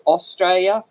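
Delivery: "Australia" as Aus-STRAY-a, first syllable kept; southern pronunciation. Shown as a demonstration. Showing how down south says it, "Australia" as Aus-straya. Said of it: "down south, they tend to put".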